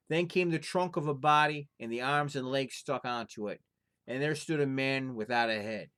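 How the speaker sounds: lively storyteller reading a folktale aloud; story narration. A narrator's reading. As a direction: tell it like a fireside tale, full of drama. Reads folktale aloud to viewers.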